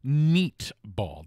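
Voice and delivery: neat said as "neet", spelt N E A T, not meat; neat ball. Shown as a demonstration. Host explaining the name of a bug meatball, deliberate. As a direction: spell out the joke with neat. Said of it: "That's N as in November, E A T".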